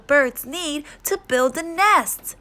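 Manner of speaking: slow, clearly paced storybook reading; children's story read-aloud. Acted. Child talking to puppy Biscuit in a picture book, storytime voice. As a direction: read to little ones with lilting emphasis.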